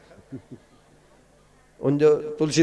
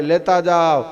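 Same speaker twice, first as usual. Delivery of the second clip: louder shout after the fleeing guests; calling out; 3 semitones higher, 6 dB louder, slower than usual. Acted. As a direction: call out while chasing them.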